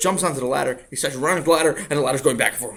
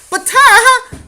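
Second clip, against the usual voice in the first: put-on much higher, louder childish wail; whining child; 16 dB louder than usual. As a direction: wail it like a spoiled kid.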